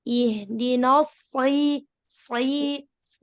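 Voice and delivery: slow "Ihdinas si", holding the emphatic shad; heavy shad. Shown as a demonstration. Teacher correcting a student's shad, heavy and held. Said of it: "Belum naik pangkal lidahnya".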